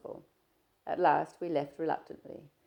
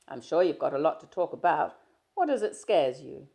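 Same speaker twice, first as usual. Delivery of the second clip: a louder, lightly voiced young man, breezy; Stephen, teasing; 10 dB louder than usual. Stephen greeting his shy friend, easy and teasing. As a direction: play Stephen chatting easily, a touch louder.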